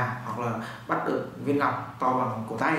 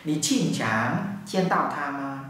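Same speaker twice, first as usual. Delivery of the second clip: slow demo; slower than usual. Shown as a demonstration.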